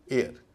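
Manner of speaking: "एयर" starting straight on the vowel; silent h. Shown as a demonstration. Teacher repeating heir before its meaning, silent h. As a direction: say "एयर" exactly like air. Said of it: "इसमें H silent है".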